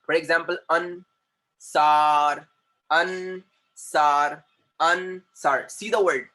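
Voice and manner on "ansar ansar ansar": "ansar" drawn out as an... sar; split by sound. Shown as a demonstration. Teacher cutting a word by sound, "ansar" in two stretched syllables. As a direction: hold each syllable of "ansar" separately. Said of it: "cut the word by sound".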